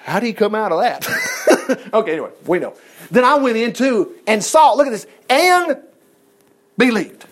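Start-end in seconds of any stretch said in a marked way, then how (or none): 1.1-1.9 high airy laugh. Real, not acted. Preacher laughs at own joke.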